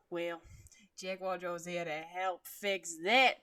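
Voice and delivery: loud, proud Jaguar voice in a put-on accent; booming boastful helper, accent laid on; proud announcement. A narrator's reading. Jaguar proudly announcing help to the room, loud put-on accent. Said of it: "he announced in a way that was most likely proud".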